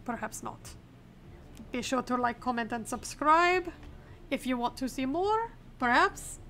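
hammed-up mock Arstotzkan accent; deliberately bad Arstotzkan accent; awful Arstotzkan accent. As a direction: play a border inspector with a put-on Arstotzkan accent. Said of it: "I cannot stop doing this awful Arstotzkan accent".

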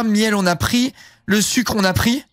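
ordinary talk, nothing marked

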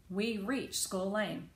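usual speaking voice

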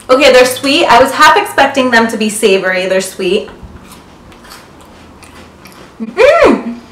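chewing while talking; muffled by chewing; mouth full of biscuit. Real, not acted. Seen 0: Bites biscuit at 0.8, chews with closed, pursed lips until ~5.5.

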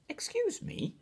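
very high, tiny polite cricket voice; little cricket voice. A narrator's reading. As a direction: squeak it out like a small insect clearing his throat. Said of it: "coughed a cricket".

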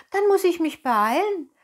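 eager pleading. Acted. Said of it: "bat Pinocchio".

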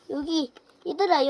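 ordinary talk

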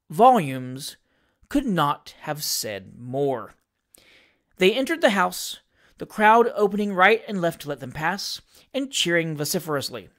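ordinary delivery, nothing marked

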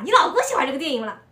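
quick Shanghainese follow-up question. Retold or imitated. The friend presses about the husband, quick Shanghainese. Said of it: "然后我闺蜜说".

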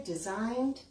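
ordinary delivery

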